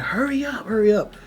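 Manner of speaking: urgent. Retold or imitated. Friends urging during escape.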